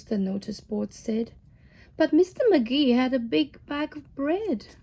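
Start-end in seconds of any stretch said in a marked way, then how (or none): none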